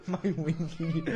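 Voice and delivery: giggling through the words; laughing. Real, not acted. Host repeating co-host's childish rude word, laughing. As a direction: barely get it out for laughing.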